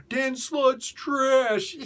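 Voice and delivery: high, slow whiny mocking voice; mock hater. Mocking YouTube haters of Dan Slott, high whiny sneer.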